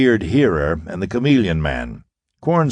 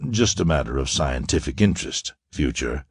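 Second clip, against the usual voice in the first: softer, lower, mock-polite echo of his words; 6 semitones lower, 5 dB quieter than usual. A narrator's reading. Quarren mocks the captive Captain Future, soft and ironic. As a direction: hand his own phrase back with quiet irony. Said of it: "ironical courtesy".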